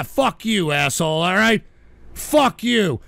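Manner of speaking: ordinary talk, nothing marked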